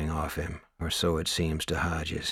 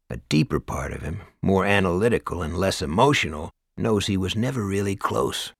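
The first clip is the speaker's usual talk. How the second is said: usual speaking voice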